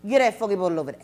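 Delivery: loud, quick Gullah request; imitating Gullah; Gullah speech. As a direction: say it in Gullah like the islander. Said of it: "he said".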